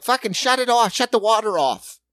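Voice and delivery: high, urgent imitation of workers; mock urgent order. Acts out panicking plant workers.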